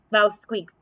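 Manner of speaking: ordinary delivery, nothing marked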